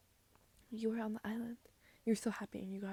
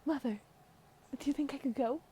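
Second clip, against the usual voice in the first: higher, louder pleading daughter voice; young princess asking permission, voice lifted; daughter role; 5 semitones higher, 5 dB louder than usual. Acted. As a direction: ask mother eagerly, pitched up. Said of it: "[name] voiced you for a second".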